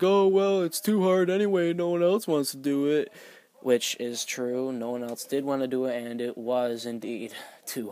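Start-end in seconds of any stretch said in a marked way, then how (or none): 0.0-3.1 high, loud, whiny friend voice; whiny excuse. Retold or imitated. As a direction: whine it out like a lazy student. Friend mocking excuse to teacher.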